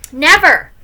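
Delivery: loud, high, defiant outburst; flatly refuse, loud and high; stubborn kid exclaiming; defiant refusal. Acted. Boy refuses the baby outright.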